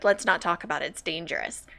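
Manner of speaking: low, hushed mock warning; imitating shifty evasion. Streamer quoting the shifty man dodging questions, low and hushed.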